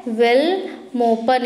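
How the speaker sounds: drawn out slowly for students to repeat; teacher spelling out a capital to the class, slowly; slow syllable-by-syllable. Shown as a demonstration. Stretched out slowly. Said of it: "क्या है?".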